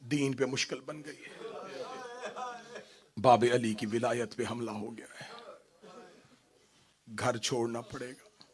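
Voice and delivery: low, soft, grieving narration; soft tender recitation. Acted. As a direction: hush the room, tender. (धीमी, नरम, दर्द भरी आवाज़ में)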